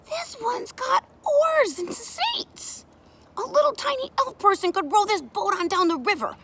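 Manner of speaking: put-on high-pitched, loud kid enthusiasm; delighted child. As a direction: marvel like an excited kid. Child delighted with carved boat.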